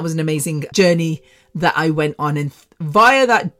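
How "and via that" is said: "via" said as VYE-uh; "via" with a long I, British style. Shown as a demonstration. Podcaster saying "via" her own British way. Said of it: "or as Americans would say".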